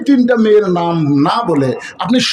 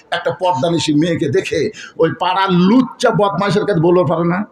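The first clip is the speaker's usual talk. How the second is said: ordinary talk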